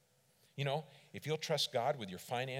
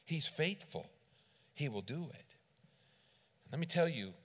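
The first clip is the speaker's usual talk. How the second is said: usual speaking voice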